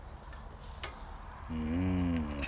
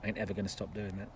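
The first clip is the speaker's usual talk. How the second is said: fast, much softer defiant aside; defiant message; 4 semitones lower, 11 dB quieter, faster than usual. Real, not acted. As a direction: tell the moaner off quietly and fast. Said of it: "Here's a message".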